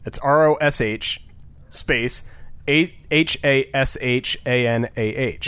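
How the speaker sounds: letter by letter, R O S H, then A, H A S H A N A H; spelling it out. Shown as a demonstration. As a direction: spell Rosh Hashanah slowly, letter by letter. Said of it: "Spell it for me".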